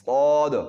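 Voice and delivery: صاد loud and full, with a hiss at the start; full-voiced صاد with an opening whistle; heavy saad. Shown as a demonstration. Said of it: "شروع میں آپ تھوڑی سی سیٹی کی آواز نکالیں".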